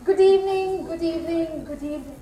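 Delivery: performed greeting dying away; acted greeting. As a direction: greet the crowd, each time fainter. Reporter's greeting acted in monologue.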